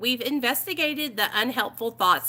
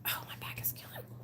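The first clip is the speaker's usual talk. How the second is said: very soft, quick whisper; whispered groaning aside; 18 dB quieter, faster than usual. Real, not acted. Streamer stretching an aching back, whispered complaint. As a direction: complain under your breath, barely voiced.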